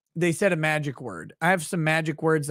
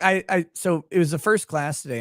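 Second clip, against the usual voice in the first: usual speaking voice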